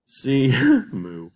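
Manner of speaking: laughing. Real, not acted. Laughs at cow sound.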